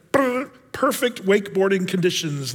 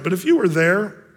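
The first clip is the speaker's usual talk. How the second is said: ordinary delivery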